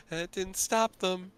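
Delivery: slow, smiling quip; smiling reply. Acted. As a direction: answer with a knowing smile. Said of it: "Emmeline kinda just smiles".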